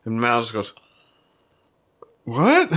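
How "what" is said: high, sharp what; a stunned outburst; shocked. Acted. Mal's shocked reaction.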